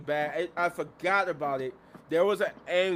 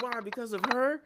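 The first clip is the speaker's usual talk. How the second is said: higher-pitched indignant question; mock-offended, rising at the end; mad, retold; 7 semitones higher than usual. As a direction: imitate a jealous friend's disbelief. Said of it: "she mad that".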